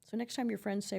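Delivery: ordinary delivery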